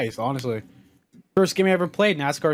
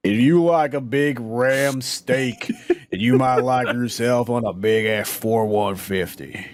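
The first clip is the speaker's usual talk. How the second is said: slow, low macho truck ad; deep truck ad voice; 4 semitones lower, much slower than usual. Pretend. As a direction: drawl it like a truck commercial. Parodying a truck commercial.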